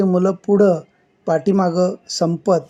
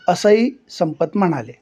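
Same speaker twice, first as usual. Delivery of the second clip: plain reading voice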